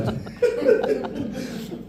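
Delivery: breathy high giggle at the memory; amused high laugh; high airy laugh. Real, not acted. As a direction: laugh it off lightly. Laughs at daughter's reply.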